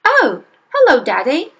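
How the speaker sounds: put-on high, loud, bright girl's greeting; pleasant little girl. Young girl pleasantly greeting her angry father. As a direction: sweetly greet him as if nothing's wrong. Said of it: "she said pleasantly".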